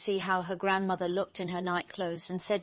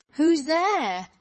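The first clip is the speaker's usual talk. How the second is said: big, loud wolf call, pitched up; 8 semitones higher, 8 dB louder than usual. A narrator's reading. Wolf in grandmother's bed answers knock, big loud voice. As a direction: boom the question out high and loud. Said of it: "hearing the big voice of the wolf".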